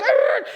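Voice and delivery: high-pitched mock tire squeal; imitated car screech. Storyteller imitates his friend's mom swinging the car, high screech. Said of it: "she, like, swung the car".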